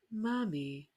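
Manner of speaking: put-on slow, drawn-out creepy child; slow eerie monotone child. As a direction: creep it out like a horror-film kid. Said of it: "With the monotone".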